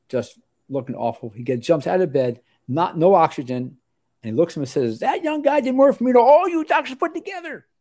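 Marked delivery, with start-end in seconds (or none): 5.0-7.7 very high, loud mock rant; worked-up, high-pitched impersonation, happy and upset at once; ranting and raging. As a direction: imitate an outraged patient scolding the doctors. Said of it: "He was, like, ranting and raging".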